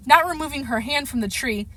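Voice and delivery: ordinary delivery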